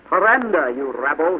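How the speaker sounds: high, loud order to surrender; shouted command. A narrator's reading. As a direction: shout it like a sergeant. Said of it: "he shouted".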